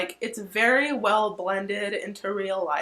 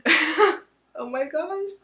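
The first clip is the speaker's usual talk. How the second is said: stunned gasp; 8 semitones higher, 4 dB louder, much slower than usual. Retold or imitated. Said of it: "he's just like".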